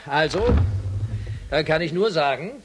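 loud command. Acted. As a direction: announce it firmly to the crew. Commander gives orders to crew. (laute Ansage des Kommandanten an die Crew)

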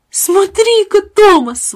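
put-on high, loud boyish cry; excited exclamation. A narrator's reading. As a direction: call out in delight. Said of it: "воскликнул Джеймс".

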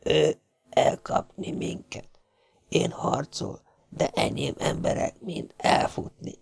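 soft, breathy-faint whisper in broken Hungarian; a wounded foreigner fading as he speaks; dying sailor. A narrator's reading.

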